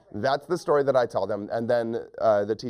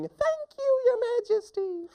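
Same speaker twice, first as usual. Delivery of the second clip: extremely high falsetto squeal; squeaky mouse scream. Acted. Mouse running away from the lion, high squealed thanks.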